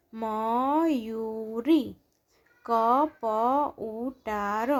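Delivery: Mayuri કપ ઉતાર slow, each syllable stretched; drawn-out Mayuri કપ ઉતાર, syllables separated. Shown as a demonstration. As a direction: sound out Mayuri કપ ઉતાર slowly, pausing between words. Syllable-by-syllable reading, then blended words.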